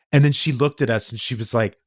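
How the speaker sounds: ordinary talk, nothing marked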